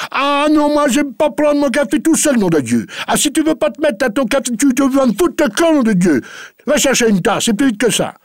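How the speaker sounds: high, loud blustering rant; angry old master. Acted. Farmer orders his maid to eat with him, furious bluster. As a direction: splutter and bully her to sit and eat.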